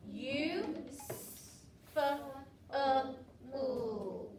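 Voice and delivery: "U, f, uh, oo" as isolated sounds with pauses; sounding out. Shown as a demonstration. Teacher checks the sound count with pupils, "U, f, uh, oo" one at a time. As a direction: break it up sound by sound, "U, f, uh, oo". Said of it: "say the word useful and count the sounds".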